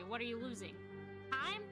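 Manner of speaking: normal talking voice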